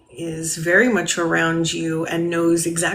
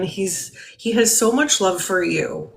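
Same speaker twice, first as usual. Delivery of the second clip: ordinary delivery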